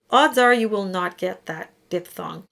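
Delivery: ordinary delivery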